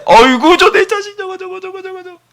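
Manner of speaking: put-on a fond father beaming at his kid; doting father. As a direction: coo over your child like a proud dad. Father delighted in his child.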